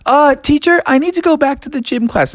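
high, quick pleading voice; kid asking teacher. Acted. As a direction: rush the request, high and eager. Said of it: "raised his hand and said".

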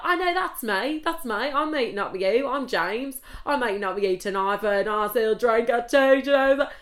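hammed-up English accent, high and loud. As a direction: imitate an over-eager Englishman. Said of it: "why are you English?".

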